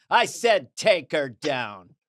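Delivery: slow, firm tough-guy order; John Wayne impression, slow and commanding. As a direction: lay it down slow like the Duke. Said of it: "there's a movie where he goes".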